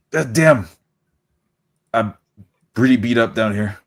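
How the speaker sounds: ordinary talk, nothing marked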